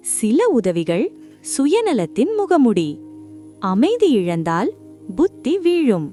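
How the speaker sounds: ordinary delivery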